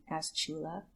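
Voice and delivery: plain reading voice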